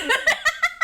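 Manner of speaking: loud burst of high laughter; real cracking-up laugh; high cackle. Real, not acted. Finds the scene funny.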